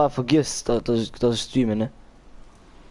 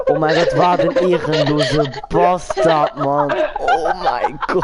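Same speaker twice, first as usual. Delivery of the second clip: laughing disbelief; 3 dB louder, slower than usual. Real, not acted. Laughing at enemy's move.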